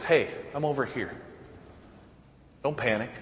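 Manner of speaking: God's soft call. Retold or imitated. God gently calling to the panicking believer, softly. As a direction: quietly let him know you're near. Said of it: "God says".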